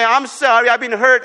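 high, loud, complaining about being hurt; mock whining. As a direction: play the self-pitying victim lightly. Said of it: "crying to people and say".

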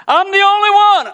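very high, loud whining voice; whiny self-pity. Pretend. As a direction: whine it like a sulking child. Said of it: "he's got self-pity, like".

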